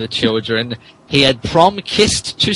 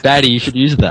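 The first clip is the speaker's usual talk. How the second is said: normal talking voice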